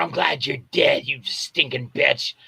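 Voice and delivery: lightly voiced creep savoring an insult; gloating creep. His creep character gloats over body.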